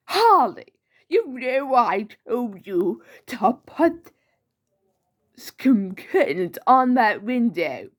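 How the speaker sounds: drawn-out theatrical telling-off; Joker. Acted. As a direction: cry out her name, then scold slowly. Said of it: "Let me try again with the Joker".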